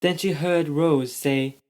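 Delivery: ordinary delivery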